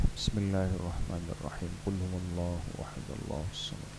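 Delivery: soft, low prayer murmur under the breath; murmured recitation. Acted. Teacher leading students in quiet recitation, murmured softly. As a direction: recite it quietly to yourself, inward.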